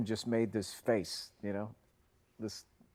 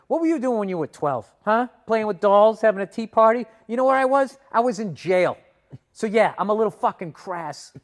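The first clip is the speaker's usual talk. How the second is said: loud, high-pitched tirade; indignant rant; 10 semitones higher, 11 dB louder than usual. Retold or imitated. Comic tearing into a disapproving audience woman, indignant. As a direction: build an outraged case, punching each question.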